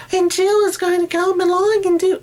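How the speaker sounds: very high, loud cartoon voice; squeaky falsetto. Shown as a demonstration. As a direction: shriek it in a silly falsetto. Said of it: "no, you don't do that".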